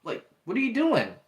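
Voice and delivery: high, baffled mock disbelief; lightly acted stunned teen; incredulous Peter. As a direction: play it like you can't believe what he's doing. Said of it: "He was kinda, like, looking at".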